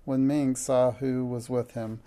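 ordinary delivery

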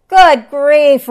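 high, very loud startled exclamation; roared. A narrator's reading. Lion woken by squawking, roared. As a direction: bellow it as if jolted awake. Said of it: "roared the lion".

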